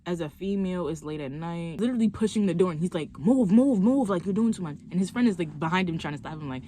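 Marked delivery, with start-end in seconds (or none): 3.2-4.8 loud, pushy shouting; loud yelling. Retold or imitated. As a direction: bark it as you push. He pushes her, yelling.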